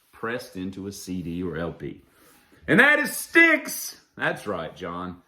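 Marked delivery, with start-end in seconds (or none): 2.7-4.0 high, slow announcer-like reveal; big reveal. Pretend. Revealing the final band, high slow announcement. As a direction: draw the name out up high.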